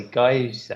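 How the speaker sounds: Geiser as he usually says it, KY-zuh; own pronunciation. Shown as a demonstration. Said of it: "So what do I say?".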